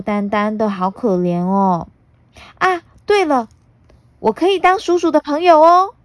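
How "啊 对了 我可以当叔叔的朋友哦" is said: put-on high, bright little girl. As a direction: chatter like a cheeky young girl. Puna offers friendship.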